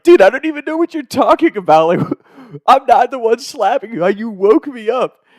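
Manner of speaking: high, whiny-innocent mock protest; indignant protest. Woken kid denies slapping his friend, high indignant protest.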